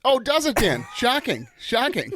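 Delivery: high, sarcastic "shocking"; mock astonishment, dripping sarcasm; mock sarcastic surprise. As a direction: play it as fake shock. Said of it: "That actually sounds more condescending".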